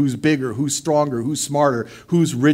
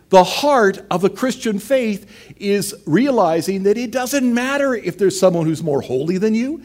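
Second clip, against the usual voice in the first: ordinary talk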